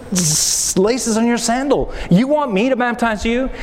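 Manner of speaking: high, incredulous protest with a laugh; astonished disbelief. Retold or imitated. John protests baptizing Jesus.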